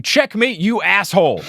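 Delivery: high, mock-triumphant declaration; overacted victor's insult; triumphant taunt. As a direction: crow it high, hammed-up. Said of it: "slaps the king in the face with it, saying".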